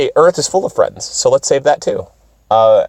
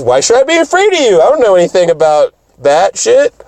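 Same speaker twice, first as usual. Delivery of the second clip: put-on higher, faster carefree girl voice; clueless and cheerful, quick; naive Sailor Moon; 7 semitones higher than usual. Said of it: "she goes".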